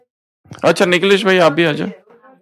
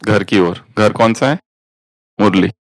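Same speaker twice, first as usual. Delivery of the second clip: guided meditation; 5 semitones lower than usual. Acted. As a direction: lead them gently back inward. Guides meditation back home. (धीमी, गहरी ध्यान कराने वाली आवाज़)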